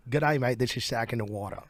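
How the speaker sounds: put-on an American trying on an Australian accent; imitated Australian accent. Musician repeats the interviewer's test phrase, imitated Australian accent. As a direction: do a careful copy of Australian speech. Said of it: "that is a great impression".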